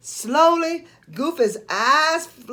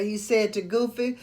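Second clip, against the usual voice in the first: ordinary delivery, nothing marked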